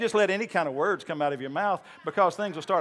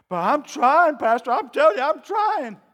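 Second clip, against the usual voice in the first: louder, higher protest; insistent congregant; 8 semitones higher, 7 dB louder than usual. Retold or imitated. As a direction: mimic a frustrated believer pleading.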